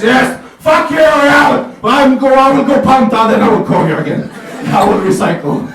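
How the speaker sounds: loud, high mock drunken rant; hammed-up drunken Swedish tirade; drunk Swede calling ex. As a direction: yell down the phone like a drunk ex. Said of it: "calling their ex".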